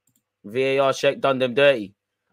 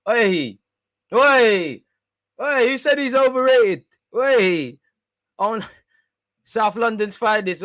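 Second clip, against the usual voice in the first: excited cheering; 8 semitones higher, 5 dB louder, slower than usual. Real, not acted. Cheering a goal on stream.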